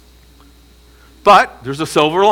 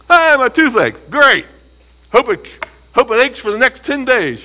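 sarcastic mock wish; 10 semitones higher, 4 dB louder than usual. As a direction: wish it on him with a sneer. Mock curse, toothache wish.

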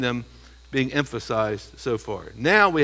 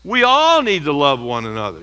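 louder, all stressed; 6 dB louder than usual. Shown as a demonstration. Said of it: "Now what he says".

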